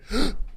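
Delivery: short mock-frightened gasp; imitated gasp. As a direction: suck in air as if suddenly spooked. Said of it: "you went".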